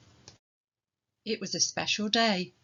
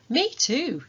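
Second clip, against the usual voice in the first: put-on higher, louder little girl; eager child; 7 semitones higher, 5 dB louder than usual. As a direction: chime in like a keen child. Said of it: "said Hattie".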